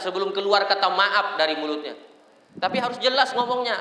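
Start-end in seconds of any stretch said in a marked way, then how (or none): none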